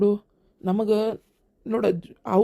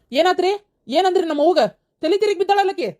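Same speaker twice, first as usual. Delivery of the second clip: high, urgent alarm; panicked; 8 semitones higher than usual. Acted. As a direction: blurt it out in fright. Panic as mother faints.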